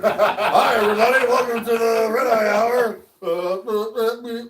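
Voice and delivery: high, loud mock-panicked show opening; freaked out, exaggerated. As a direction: greet the audience in comic panic, stammering through laughter. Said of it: "like, all freaked out".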